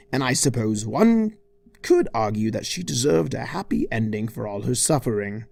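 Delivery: ordinary delivery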